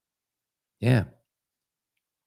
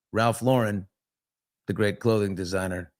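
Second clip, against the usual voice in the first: ordinary delivery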